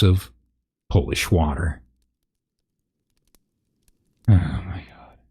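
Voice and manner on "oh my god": a breathless whispered aside; whispered disbelief. Real, not acted. Reader reacts to absurd text.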